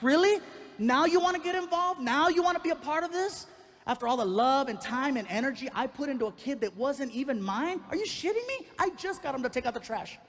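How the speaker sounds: high, loud, incredulous rant; screaming outrage. Retold or imitated. Stepdad ranting at the email from the absent dad, screaming outrage. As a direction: let the indignation shoot your pitch way up. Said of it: "I'm screaming again".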